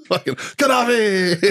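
high, slow laughing cry of the name; mock Gaddafi call. Recalling the army knife-hand joke, laughing mock cry. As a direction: ham up the old joke call.

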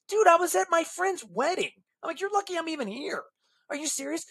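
high-pitched, incredulous comeback; indignant retort. Retold or imitated. Seller answering the furious buyer back, indignant retort. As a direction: act out an offended protest.

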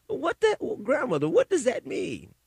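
put-on a kid squeaking up at grandma in confusion; confused young self. Young self questions great-grandmother.

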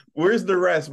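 high, loud exasperated whine; exasperated cry. Retold or imitated. Repeated louder after laughing.

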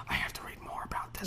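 hushed, secretive whisper. Retold or imitated. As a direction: breathe it like a secret. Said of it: "but I'm like".

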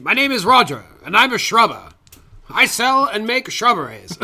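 slow proud introduction as a shopkeeper; a touch of Roger's mild cheery pride; Roger the shrubber. Retold or imitated. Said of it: "later they meet".

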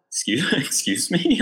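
slow, high, half-laughing excuse me; incredulous. Retold or imitated. Player stunned by captain yelling at ref, slow laughing disbelief. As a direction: act stunned she said that.

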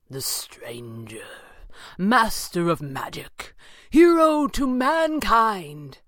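hushed slow start building to a high, loud peak; slow, swelling theatrical villain; Thatcher, grandiose mockery. A narrator's reading. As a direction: announce him like a mocking showman. Narrator voices mocking Thatcher.

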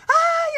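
high shrieking mock scream; sudden high yelp. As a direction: shriek like you just woke from anesthesia. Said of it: "Empecé a gritar como loca".

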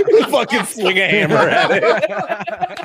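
words squeezed out through big laughter; slow, high, loud laughing line; real helpless laughter. Real, not acted. Laughing hard at the video.

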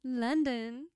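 LONDON in a mock British accent. Shown as a demonstration. Modelling London for friend, put-on British accent. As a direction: give London a British sound. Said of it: "do British".

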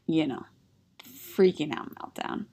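normal talking voice

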